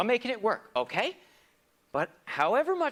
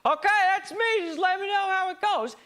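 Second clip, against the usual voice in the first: higher, louder breezy mock voice; mocking the future self; 13 semitones higher, 5 dB louder than usual. Mocking his future self who passes the buck, breezy mock voice. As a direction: toss it off like you've passed the buck. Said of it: "buck like".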